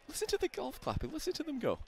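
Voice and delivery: posh-sounding British golf announcer; British accent. Pretend. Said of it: "[name]'s British accent".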